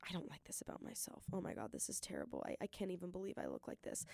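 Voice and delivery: soft, fast whispered self-criticism; mock mirror mutter. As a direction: whisper it to yourself in the mirror, quickly. Mimicking mirror self-talk.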